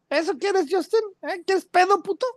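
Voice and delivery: high, mocking Mexican street challenge; Mexican tough guy. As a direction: taunt him in Mexican slang. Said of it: "now say that in a Spanish accent".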